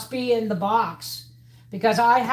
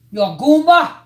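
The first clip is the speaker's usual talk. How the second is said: put-on high, loud playful baby talk; playful loud call; 7 semitones higher, 13 dB louder than usual. Sing-song baby talk to pet.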